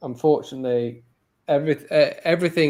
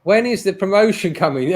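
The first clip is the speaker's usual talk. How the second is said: loud, high retold moan; pitched-up, louder moaning demand; 7 semitones higher, 7 dB louder than usual. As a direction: re-enact your own complaint, raised and emphatic. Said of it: "I was moaning to one".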